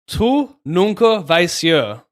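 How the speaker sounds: slow, careful first try at "Tu nunca vais ser"; slow, deliberate learner's read; slow careful Portuguese. Shown as a demonstration. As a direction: sound out "Tu nunca vais ser" slowly and evenly, word by word. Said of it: "But pronounce the eh sound".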